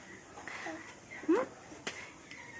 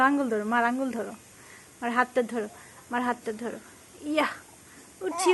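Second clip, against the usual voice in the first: soothing coo; 7 dB quieter than usual. Acted. Mother coaxing her baby to hold her finger, soft and soothing. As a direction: murmur gently, as if lifting a child.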